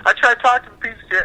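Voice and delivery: high, indignant complaint; telling a friend he's mad, voice jumping up high; indignant rant. Retold or imitated. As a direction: squeak it out, outraged. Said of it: "I'm like".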